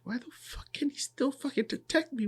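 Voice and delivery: hissed high whisper of disbelief. Retold or imitated. Hidden assassin wondering why he is still seen, whispered.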